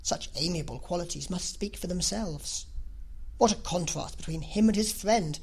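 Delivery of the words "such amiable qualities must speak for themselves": ordinary delivery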